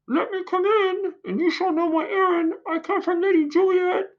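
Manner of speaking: very high put-on nurse voice; old nurse voice. The nurse calling through the door, very high and shrill-toned. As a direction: squeak it out like the fussy nurse.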